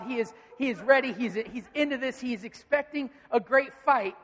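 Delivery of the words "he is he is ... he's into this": ordinary delivery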